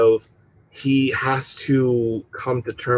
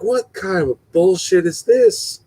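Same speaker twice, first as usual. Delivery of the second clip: mock outrage; 8 semitones higher than usual. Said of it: "and I'm like".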